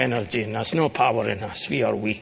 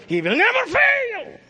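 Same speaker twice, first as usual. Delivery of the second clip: high, ringing proclamation of promises; full-force preaching; 4 dB louder than usual. Acted. Preacher assuring his listeners God will heal them, ringing high proclamation. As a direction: build each promise higher and louder.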